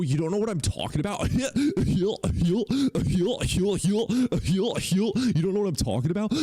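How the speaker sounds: high, laughing taunt; laughing mockery. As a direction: cackle the question back at him. Mocking caller, laughing.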